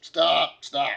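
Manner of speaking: harried father breaking up a fight; exasperated dad. Acted. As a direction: halt the squabbling kids. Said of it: "he's like".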